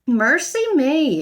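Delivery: put-on slow, loud old-lady exclamation; flustered housekeeper exclaiming. A narrator's reading. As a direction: throw up your hands in dismay. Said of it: "exclaimed Missus Bird".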